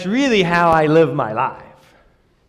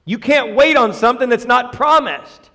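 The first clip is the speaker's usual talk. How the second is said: usual speaking voice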